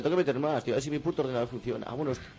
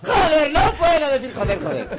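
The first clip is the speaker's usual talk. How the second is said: much higher, louder, slow strained cry; exploding yell; 12 semitones higher, 10 dB louder, slower than usual. Pretend. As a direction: burst out after holding it in. Grita tras contenerse. (grito exagerado al no poder contenerse)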